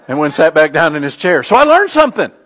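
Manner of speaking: ordinary talk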